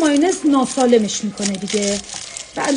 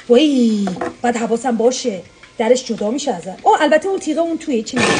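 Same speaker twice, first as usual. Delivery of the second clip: normal talking voice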